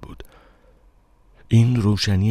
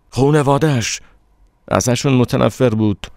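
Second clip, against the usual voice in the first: higher, heated insistence; 7 semitones higher, 4 dB louder than usual. A narrator's reading. Narrator and stranger argue over beloved.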